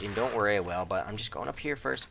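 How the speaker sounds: normal talking voice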